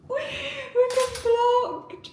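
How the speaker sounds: very high, drawn-out whine; long high-pitched cry of dismay; dismayed wail. Real, not acted. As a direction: wail it slowly, nearly crying. Repeats it in mounting dismay.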